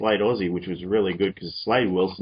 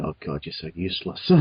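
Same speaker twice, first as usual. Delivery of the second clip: lightly voiced teasing jab, a bit lower and softer; mocking a friend; 5 semitones lower, 7 dB quieter than usual. Mock a mate who is being useless, low teasing scoff. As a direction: tease a useless buddy under your breath. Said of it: "pokes fun at his friends going".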